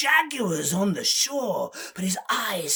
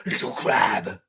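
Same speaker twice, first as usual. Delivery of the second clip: low, menacing big-cat voice; deep predator voice; Jaguar; 7 semitones lower, 5 dB louder than usual. Acted. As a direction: address small prey in a deep voice. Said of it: "he goes up to Little Crab and says".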